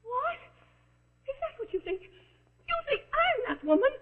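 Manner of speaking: high, slow, tearful disbelief; shocked disbelief. Acted. Accused woman realising they think she's mad, shocked. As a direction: let it dawn on you in horror. Accused of being escaped madwoman.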